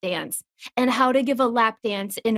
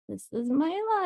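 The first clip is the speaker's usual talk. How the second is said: mock lament; 8 semitones higher, 5 dB quieter than usual. Retold resigned lament about recognition.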